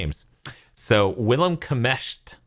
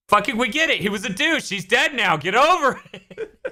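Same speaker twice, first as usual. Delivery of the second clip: exasperated dismissive tone; 12 semitones higher than usual. Pretend. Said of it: "Citizen Kane summarized in this tone".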